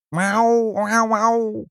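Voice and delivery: high, loud cat meows; cats mewing. Acted. The worried cats answer mother with high loud meows. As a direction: meow like anxious cats.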